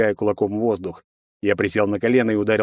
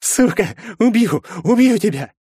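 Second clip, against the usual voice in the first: loud, much higher threats, little sob heard; sobbing threat; 12 semitones higher, 5 dB louder than usual. A narrator's reading. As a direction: beaten rival vowing revenge through tears. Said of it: "Сквозь всхлипы произнёс он". (сквозь всхлипы)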